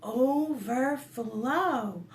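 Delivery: ordinary delivery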